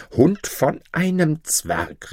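high, drawn-out angry insult; angry outburst. A narrator's reading. Shamed duke insults his cook, angry. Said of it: "errötete vor Beschämung".

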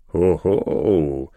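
low-pitched "hoho" of a judge; judge's outburst. Acted. Judge startled by the stranger's refusal, low outburst. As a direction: burst out with it, deep-voiced. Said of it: "fuhr es dem Richter heraus".

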